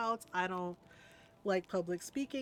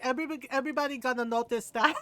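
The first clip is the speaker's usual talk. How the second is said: put-on fast Filipino-accented scolding; Filipino mother; 3 semitones higher, 4 dB louder, much faster than usual. Filipino mom fretting about the eyebrow, fast accented scolding. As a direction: imitate a fussing Filipino mom. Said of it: "our little tiny Filipino mother".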